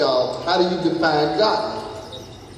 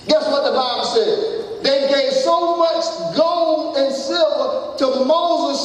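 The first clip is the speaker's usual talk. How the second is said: high-pitched, drawn-out sermon delivery; fervent preaching; 10 semitones higher, slower than usual. Real, not acted. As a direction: stretch it out high for the congregation. Preaches at full force.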